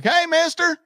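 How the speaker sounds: high-pitched mock holler; imitated friendly call. Neighbor Mark runs over to greet him, imitated call.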